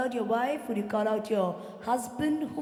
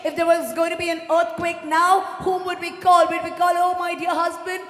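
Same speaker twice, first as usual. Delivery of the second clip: loud, urgent preacher's appeal; fervent pulpit delivery; full-force preaching; 3 semitones higher, 9 dB louder than usual. Real, not acted. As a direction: preach it out to the whole congregation.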